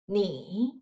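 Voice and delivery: 你 spoken low, in a careful Mandarin tone; Mandarin tone demo. Shown as a demonstration. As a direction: model 你 slowly for the students. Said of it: "learn tones".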